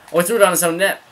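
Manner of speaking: high, loud burst of disbelief; real alarm at a blunder; startled outburst. Real, not acted. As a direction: exclaim at a shocking play. Startled by play on own net.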